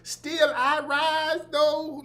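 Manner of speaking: high, slow mock Southern intoning; drawn-out parody of Southern oratory; fake Southern inflection. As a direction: intone it grandly in a mocking Southern voice. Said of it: "fake Southern voice inflections".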